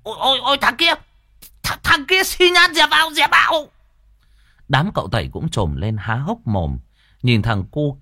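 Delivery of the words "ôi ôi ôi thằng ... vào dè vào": high, panicked shriek. A narrator's reading. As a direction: scream a warning at the beggar. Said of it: "thì rú lên".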